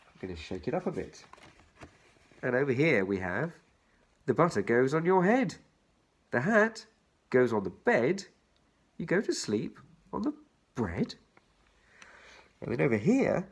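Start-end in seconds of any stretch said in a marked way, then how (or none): 4.2-11.1 put-on bouncy children's rhyme read. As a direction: lilt each line to its rhyme. Reads the rhyme aloud.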